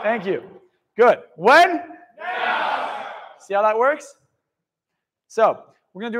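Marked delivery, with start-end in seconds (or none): 1.4-3.1 rallying shout. Real, not acted. Firing up the audience with a shouted call. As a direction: shout it out to the crowd.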